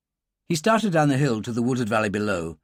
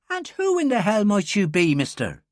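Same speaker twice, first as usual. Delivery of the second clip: higher, sharp Irish challenge; suspicious Irish girl; 8 semitones higher than usual. Acted. Girl demanding the stranger's name, suspicious Irish voice. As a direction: bristle at him, who is this man.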